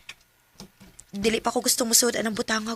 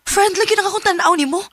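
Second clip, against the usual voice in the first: louder, higher, cutting him off for good; firm loud rejection; 5 semitones higher, 9 dB louder than usual. Acted. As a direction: shut him down once and for all. Colin firmly rejects Archie. (kusog ug lig-on nga pagbalibad)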